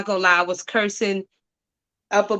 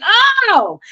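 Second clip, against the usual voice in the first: a high, loud Oh of sudden insight; sudden realization; 14 semitones higher, 8 dB louder than usual. Retold or imitated. Retelling the moment the bigger picture hit, loud surprised Oh. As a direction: let it burst out as it dawns.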